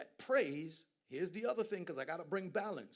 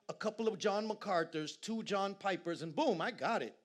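rising preacher's fervour, louder as the list grows; building preaching; 6 semitones higher, 5 dB louder than usual. Acted. Preacher building up to his congregation, rising fervour.

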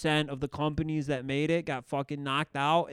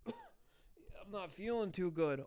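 weak, slow, feeble sick voice; faking frailty; 4 semitones higher, 12 dB quieter, much slower than usual. Retold or imitated. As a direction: play sick, slowly and weakly. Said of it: "I would fake sick".

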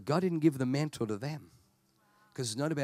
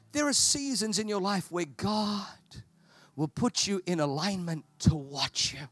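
ordinary talk

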